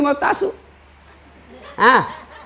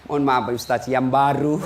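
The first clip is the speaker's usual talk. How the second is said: sheepish reply; 10 semitones lower, 5 dB quieter than usual. Retold or imitated. Widower sheepishly introducing new wife.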